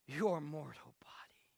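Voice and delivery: sudden soft whisper. Acted. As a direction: drop to a hush after the build.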